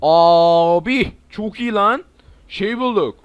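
slow, loud stretched praise; loud happy drawl; drawn-out delight. Real, not acted. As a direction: savour the find out loud. Finds something great.

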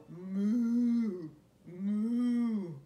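low, slow, loud mooing; cow moo. Shown as a demonstration. Reading to a child, mooing like a cow. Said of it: "He can go like a cow".

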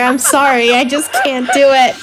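ordinary talk

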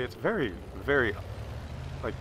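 ordinary talk, nothing marked

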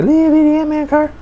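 high, fast squeak for a tiny car; tiny squeaky voice. Pretend. Toy car fan squeaking about a little Ant-Man car, high and fast. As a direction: pitch way up, rattle it off like something miniature.

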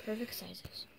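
soft unvoiced whisper of admiration. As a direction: breathe it out in awe. Admiring the tiny candies.

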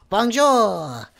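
Bonjour called out loud. Acted. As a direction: call out Bonjour loud, then fall. Greets the French Scout.

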